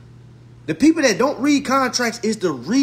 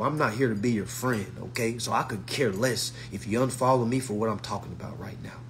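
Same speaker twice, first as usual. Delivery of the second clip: hushed aside; 10 semitones lower, 7 dB quieter than usual. Real, not acted.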